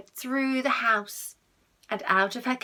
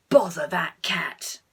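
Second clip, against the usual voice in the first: muttered whisper; 5 semitones lower, 3 dB louder, slower than usual. Acted. Caught burglar grumbling at the cat, whispered mutter. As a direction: grumble it under your breath.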